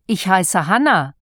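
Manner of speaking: ordinary delivery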